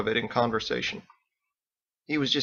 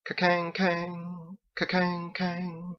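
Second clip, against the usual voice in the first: slow, higher, softer ringing imitation; bell toll; 7 semitones higher, 5 dB quieter, much slower than usual. Narrator imitating the church bell tolling, slow and soft.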